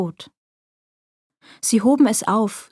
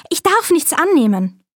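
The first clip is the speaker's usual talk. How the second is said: Schneewittchen; 6 semitones higher than usual. A narrator's reading. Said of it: "sprach Schneewittchen".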